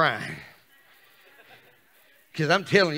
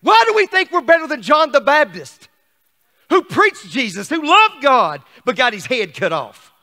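loud, high rhetorical challenge; fired-up sermon shout; full-force preaching; 8 semitones higher, 13 dB louder than usual. Real, not acted. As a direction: hurl the question at the congregation.

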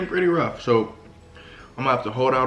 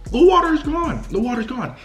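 shocked; 13 semitones higher, 4 dB louder than usual. Real, not acted. Dramatic shock at empty shelves.

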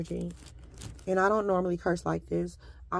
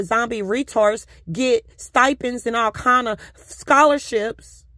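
louder and higher, building to a furious Bullshit; angry rant; 3 semitones higher, 6 dB louder than usual. Real, not acted. Ranting at professors who claimed her work, furious. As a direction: let the anger boil over, slower on the insults.

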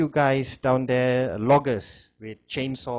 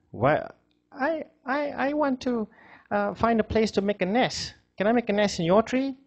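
high, shy little squirrel; timid creature asking a favour; 9 semitones higher than usual. Acted. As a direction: ask politely in a small high voice. Squirrel asks new tree.

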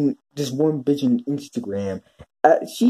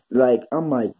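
ordinary delivery

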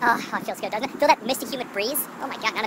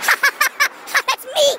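high, loud, hammed-up laughter; mock self-delighted laugh; loud laughing at oneself; 5 dB louder, slower than usual. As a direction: imitate cackling loudly at your own joke. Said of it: "laughing at myself really loud".

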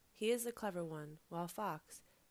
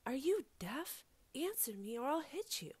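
higher-pitched, exasperated demand; indignant threat; 7 semitones higher than usual. A narrator's reading. Guinea Pig threatens the figure that won't answer, indignant.

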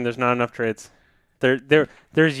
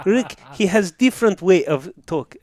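higher-pitched fake Russian accent; Sergei Gonchar; 5 semitones higher than usual. Coach explains a colleague to his player, bad Russian accent. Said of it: "worst Russian accent I've ever heard".

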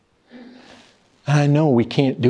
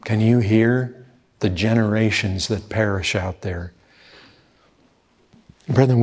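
ordinary talk, nothing marked

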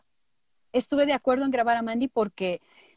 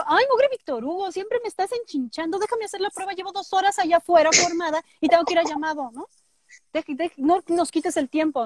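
put-on high, whiny girl scolding; Susie voice; 6 semitones higher than usual. As Susie scolding Víctor Hugo, high and whiny. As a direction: whine high like a bratty girl. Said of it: "y entonces yo".

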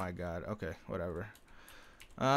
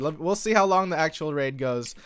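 normal talking voice

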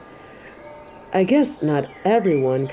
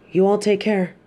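ordinary talk

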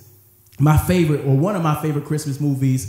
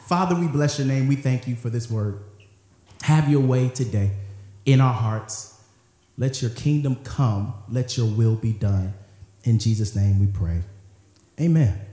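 prayer; 5 semitones lower than usual. Acted. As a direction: pray it, low and hushed. Opening prayer.

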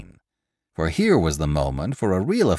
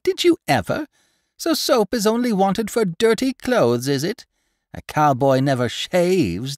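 much higher and louder, scornful teasing; mocking old gossip; 8 semitones higher, 3 dB louder than usual. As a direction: ridicule the know-it-all for the crowd. Pundor mocks the horse cooper.

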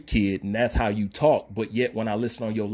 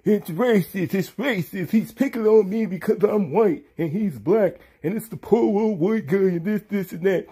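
mock whining; 7 semitones higher than usual. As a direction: ham up a crybaby complaint to ridicule him. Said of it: "while you over there crying".

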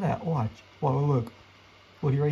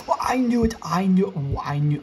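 louder, higher exasperated cry; frustrated outburst; 6 semitones higher, 5 dB louder than usual. Real, not acted. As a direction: blurt it out in frustration. Monster appears, loud frustration.

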